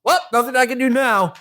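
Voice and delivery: high-pitched, quick, mock-casual brush-off; breezy shrug. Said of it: "he's like".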